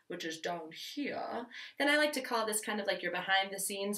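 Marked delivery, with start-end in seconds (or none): 0.1-1.5 lower, slow "down here"; low shelf voice. Shown as a demonstration. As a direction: drop the voice down below the shelf. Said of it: "speaking below the shelf".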